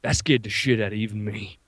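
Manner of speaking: put-on low, understated old-timer voice; Vietnam veteran. Veteran turning to the customer behind him, low rattled confession. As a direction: confide it low, phrase by phrase. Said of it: "turns around to me and goes".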